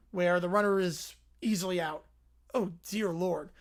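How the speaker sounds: ordinary talk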